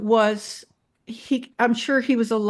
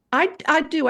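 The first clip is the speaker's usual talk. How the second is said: ordinary talk, nothing marked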